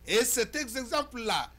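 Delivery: loud, high, emphatic oratory; forceful editorial monologue. Acted. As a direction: declaim to the viewers in punched phrases.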